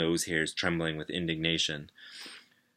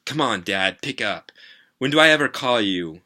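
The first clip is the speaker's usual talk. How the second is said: loud, rising pleading at the phone; frustrated plea; 4 semitones higher, 8 dB louder than usual. Acted. Kid on ringing phone begging his dad, loud frustrated plea. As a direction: urge him to pick up, louder each line.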